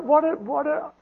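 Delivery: ordinary talk, nothing marked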